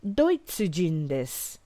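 drawn-out careful Japanese phrase; slow model phrase. Shown as a demonstration. Teacher modelling Kanada jin desu slowly for learners. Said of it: "teacher model phrase".

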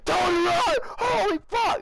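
high, loud mock-panicked scream; imitated genuine fear. Teasing friend about his scare, imitated panicked scream. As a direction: mimic your friend's terrified yell. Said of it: "there's genuine fear in that fucking, in your voice".

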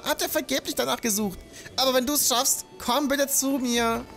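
put-on bright, high-pitched village kid; high villager voice. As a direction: play the excited village youngster. Voices the same villager NPC.

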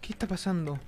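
soft, uneasy question; hushed unease. Real, not acted. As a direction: ask it under your voice. Uneasy after a scare. (en voz baja, inquieto)

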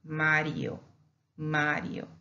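Mario with one light tap, "MAH-dyo"; single R. Shown as a demonstration. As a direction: keep the r of Mario to a single flick. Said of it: "we pronounce it as ere".

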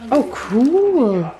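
normal talking voice

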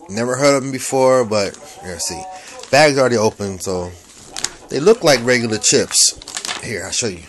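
ordinary talk, nothing marked

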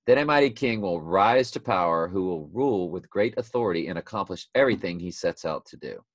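emphatic Bible reading, opening high and loud; scripture reading. Acted. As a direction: proclaim the prophecy, then let it settle. Reads scripture aloud.

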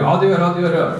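imitating an impatient kid; mock kid brush-off. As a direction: rattle it off like a kid dodging a chore. Kid brushing off parent's repeated request.

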